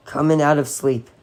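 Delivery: ordinary delivery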